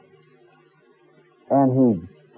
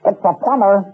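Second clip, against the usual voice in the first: parrot voice; 6 semitones higher than usual. Retold or imitated. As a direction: parrot mimicking the plumber, a touch higher. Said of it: "From inside".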